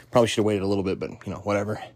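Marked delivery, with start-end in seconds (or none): none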